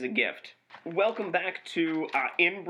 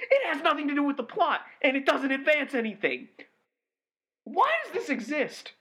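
much higher-pitched, indignant outburst; exasperated rant; 11 semitones higher than usual. Real, not acted. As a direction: build to a baffled, fed-up protest. Exasperated climax of his complaint.

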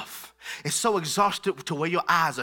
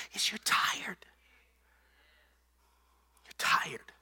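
soft unvoiced whisper; hushed, breathy whispered truth; 5 semitones lower, 9 dB quieter, slower than usual. Real, not acted. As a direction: whisper it as a secret. Whispered repeat after preaching.

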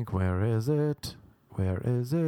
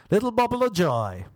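mock bliss; 10 semitones higher, 3 dB louder than usual. Mocking blissful LA talker.

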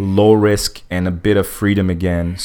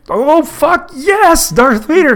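very high, drawn-out mock fanboy cheer; ecstatic superfan; 5 dB louder, much slower than usual. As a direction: stretch it out in hammed-up ecstasy. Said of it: "him running into George Lucas".

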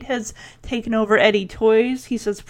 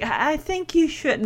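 small, softer and higher, stammered; timid; 4 semitones higher, 7 dB quieter than usual. Retold or imitated. Dottie suggesting to Billy, timidly. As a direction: shrink back meekly with a slight stutter. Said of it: "The timidness comes back".

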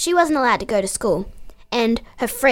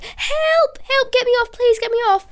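much higher, louder frantic cries for help; retold panicked screaming; 13 semitones higher, 4 dB louder than usual. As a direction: scream for help like you're stuck in a tipping canoe. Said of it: "I just started screaming".

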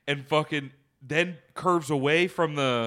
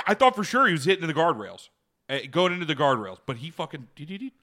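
ordinary talk

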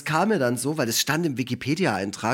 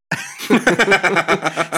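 lautes Lachen; 5 semitones higher, 6 dB louder than usual. Real, not acted. Lacht über den Filmtitel.

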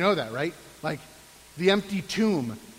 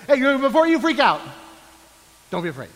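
louder, fast rush to calm a startled listener; God's urgent reassurance; 4 semitones higher, 6 dB louder, faster than usual. Retold or imitated. God to a startled person, urgent and fast-paced. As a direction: hurry in before they panic. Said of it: "if God's gonna talk to you".

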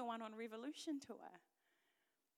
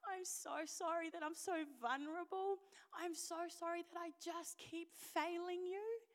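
repeated apologies building higher; plead, each apology rising a little more; 5 semitones higher, 3 dB louder than usual. Retold or imitated. Woman apologising to God for failing Him, pleading.

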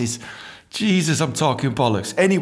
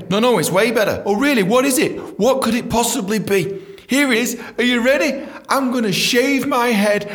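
mock excitement; 7 semitones higher than usual. Acted skit, announcing a useless grand plan.

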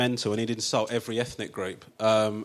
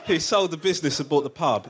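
ordinary talk

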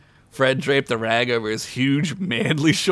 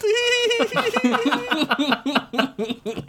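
very high cheeky giggle. Acted. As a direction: giggle up high like a tiny gnome. Gnome laughs after joke.

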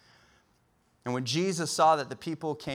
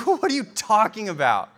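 high, loud incredulous question; out-of-breath exasperation; 7 semitones higher, 8 dB louder than usual. Acted. Struggling disciples to returning friends, mock breathless exasperation. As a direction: act worn out and baffled by the others. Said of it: "out of breath, trying to cast the demon out".

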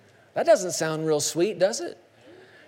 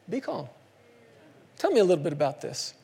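calm reassuring; 2 semitones lower, 4 dB quieter, faster than usual. Pretend. Jesus calm contrast.

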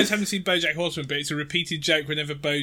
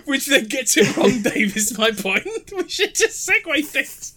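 laughing; 9 semitones higher, 4 dB louder than usual. Real, not acted. Laughing at the joke.

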